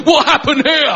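mock astonishment. Old friend grabbing the comedian's belly, mock astonished. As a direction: exclaim in teasing disbelief, pitched high. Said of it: "Literally walk up going".

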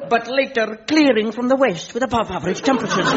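fast, bright forecaster read; mock weather forecast. Scots servant mimicking a forecaster, mock weather read. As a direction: rattle it off like the TV weatherman.

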